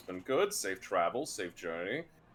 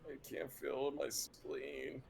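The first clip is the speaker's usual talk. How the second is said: groaning; 5 semitones higher, 7 dB quieter, much slower than usual. Acted. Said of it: "groans and goes".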